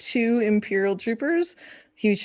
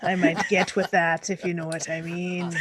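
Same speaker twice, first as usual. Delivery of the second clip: put-on pirate slipping into Scottish. Guest playing Captain T on stream, put-on pirate. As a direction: play a saucy pirate with a Scottish lilt. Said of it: "your pirate, turns into a Scottish voice".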